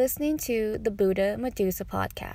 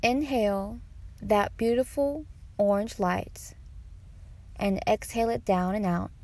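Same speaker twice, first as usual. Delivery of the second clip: guided meditation; 4 dB quieter than usual. Acted. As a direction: guide them unhurriedly through each breath.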